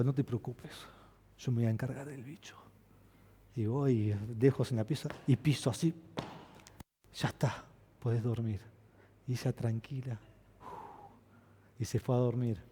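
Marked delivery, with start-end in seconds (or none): 0.0-2.6 soft, reassuring daddy voice; calm the child gently; soothing father. Retold or imitated. 7.1-8.6 softly whispered reassurance. Retold or imitated. Father whispering to child.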